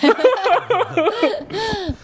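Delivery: bursting into high laughter; loud laugh. Real, not acted. Amused laugh at friend's question. (放声大笑)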